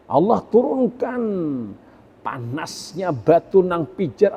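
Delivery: loud, high preaching, stretching key words; full-force pulpit sermon; fiery preaching. Acted. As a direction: thunder the warning of Allah's punishment at the congregation. Preacher raising voice on punishment.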